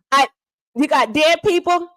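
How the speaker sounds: ordinary talk, nothing marked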